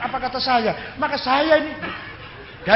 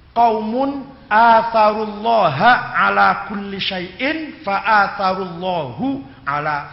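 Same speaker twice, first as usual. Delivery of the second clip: slow, emphatic quotation in Arabic; Arabic recitation; 2 semitones lower, 5 dB louder, much slower than usual. Acted. Preacher quoting a Sufi master's definition, measured Arabic recitation. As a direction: recite each word slowly and firmly.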